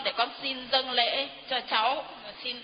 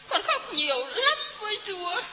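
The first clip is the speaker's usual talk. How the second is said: near tears; 7 semitones higher than usual. Real, not acted. A touch of crying as she tells the priest. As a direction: voice lifts and catches as if crying.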